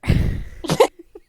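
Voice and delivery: loud wheezing laugh; burst of laughter. Real, not acted. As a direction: crack up breathlessly. Laughing at story.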